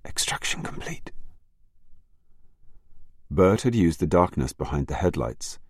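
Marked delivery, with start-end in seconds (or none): 0.1-1.1 high, unvoiced whisper. A narrator's reading. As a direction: whisper it from the bushes. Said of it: "a whispered voice said".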